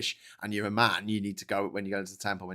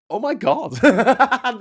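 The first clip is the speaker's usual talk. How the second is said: laughing in disbelief; high, laughing oh my God; real laughing shock; laughing surprise; 11 semitones higher, 8 dB louder, much slower than usual. Real, not acted. Surprised at Carlin clip.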